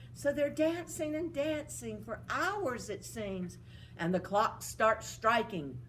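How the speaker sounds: usual speaking voice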